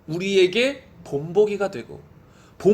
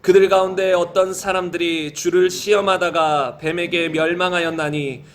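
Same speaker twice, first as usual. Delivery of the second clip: low, even, measured read-aloud; scripture reading; 5 semitones lower than usual. Real, not acted. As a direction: read the verses in a low, steady chant-like cadence. Reads 1 Corinthians passage aloud.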